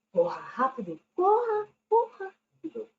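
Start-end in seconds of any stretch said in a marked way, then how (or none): 1.1-2.9 urgent shouted command to run. Shown as a demonstration. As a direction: yell it like a real emergency. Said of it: "Pra dar mais ênfase na ação".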